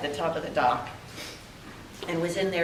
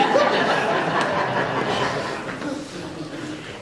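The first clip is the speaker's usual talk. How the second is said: breathy laugh. Real, not acted. Laughing after quoting her friend.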